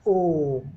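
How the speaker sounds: ओम held very long and slow as a pluta vowel; drawn-out ओम. Shown as a demonstration. Teacher showing students a pluta vowel, drawing out ओम slowly. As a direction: stretch the ओ of ओम far past a long vowel. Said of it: "लंबे time तक बोल रहा है".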